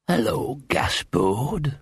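sandy, whispery rasp; deep hoarse whisper. A narrator's reading. Sinister dog greeting Gaspode from an alley, hoarse whisper. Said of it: "a deep, hoarse voice, a kind of whisper with sand in it".

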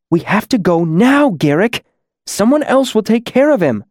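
high-pitched, pressing plea; urgent pleading. Acted. Green-masked demon pulling her friend away, urgent. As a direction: press a friend to leave, voice climbing.

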